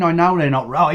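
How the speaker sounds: usual speaking voice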